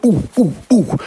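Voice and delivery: very high, short oohs; high, falling test hoots; acoustic test hoots. Retold or imitated. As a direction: hoot three high oohs at the wall. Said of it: "And I'm going".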